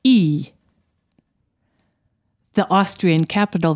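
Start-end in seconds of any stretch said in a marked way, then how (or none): 0.0-0.5 "E" as one long ee vowel; German ie sound. Shown as a demonstration. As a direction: say "E" as a long, pure ee. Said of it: "The combination I E".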